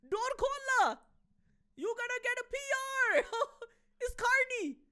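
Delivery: put-on high, loud laughing Punjabi accent; Punjabi auntie voice. Neighbour shouting that Carney is outside, high Punjabi accent laughing. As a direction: cackle through the warning in Punjabi accent. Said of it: "people will learn Punjabi and be like".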